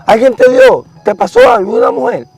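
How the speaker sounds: high alarmed mother pressing her son; worried mother. Retold or imitated. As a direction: push up high like a fretting mom.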